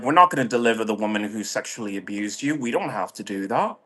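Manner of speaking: quick, soft brush-off that fades almost to nothing; dismissive official. Retold or imitated. The American government brushing her off, fast and dismissive. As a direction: toss it off fast and quiet, like it's nothing.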